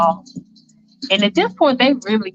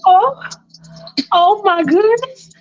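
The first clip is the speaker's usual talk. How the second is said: overwhelmed moan; 11 semitones higher, slower than usual. Retold or imitated. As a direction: cry out in pleasure, slowing. Faith overwhelmed with pleasure.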